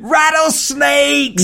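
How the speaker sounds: high, slow drawn-out warning shout; mock alarm call. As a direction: announce it like an alarm. Woman announcing rattlesnakes.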